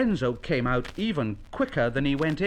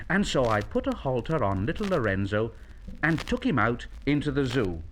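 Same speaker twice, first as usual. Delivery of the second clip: ordinary delivery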